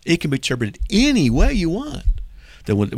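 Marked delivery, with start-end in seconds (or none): 0.9-2.2 high, singled-out ANY in "any way you want"; mocking quote. Host throws the doubters' phrase back, mocking. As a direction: lift the pitch on ANY, mockingly.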